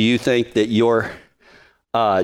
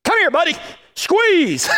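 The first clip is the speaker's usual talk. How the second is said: mock handshake greeting. Acting out a limp handshake.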